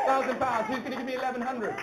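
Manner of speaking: normal talking voice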